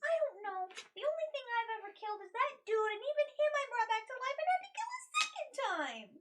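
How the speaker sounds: high, loud, worked-up protest; frantic outburst. Acted. Adventurer admits to friends she can't do adventuring, frantic outburst.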